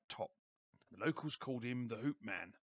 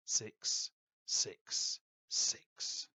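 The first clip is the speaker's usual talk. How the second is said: six six six, very slow and whispered; slow ominous count; 2 semitones lower, much slower than usual. A narrator's reading. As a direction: draw out each six in a whisper.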